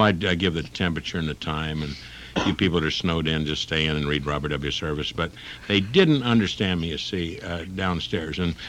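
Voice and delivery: normal talking voice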